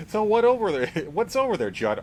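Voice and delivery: slightly hammed-up high-pitched curiosity; lightly voiced nosy newcomer; eager questioner. Co-host plays the newcomer pestering the neighbor, mock curious.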